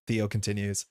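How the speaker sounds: ordinary talk, nothing marked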